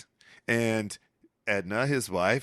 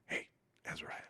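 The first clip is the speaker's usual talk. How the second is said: hushed whisper, then fast and much softer; 5 semitones lower, 13 dB quieter, faster than usual. Retold or imitated. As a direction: lean in and whisper it quickly. Tobias leans over and says.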